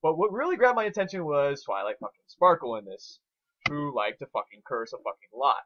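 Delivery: ordinary talk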